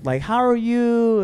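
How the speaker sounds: high, slow imitated gushing greeting; mock gushing. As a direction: draw it out like a hammed-up happy reunion. Retelling her delighted recognition.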